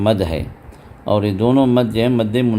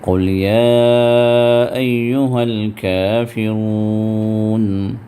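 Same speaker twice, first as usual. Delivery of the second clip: slow, measured tajweed recitation; slow Quran recitation; much slower than usual. Shown as a demonstration. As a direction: chant each word slowly and evenly. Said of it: "Quran recitation demo".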